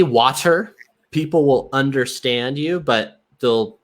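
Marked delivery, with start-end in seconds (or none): 0.0-0.7 "water" with a true hard T. Shown as a demonstration. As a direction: say "water" as "wah-ter". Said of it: "T as a T".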